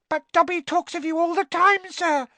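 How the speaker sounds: very high, loud excited squeak; awestruck house elf. A narrator's reading. Winky tells Harry of Dobby's praise, awestruck squeak. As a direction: gush in a tiny elf voice. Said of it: "looking awestruck".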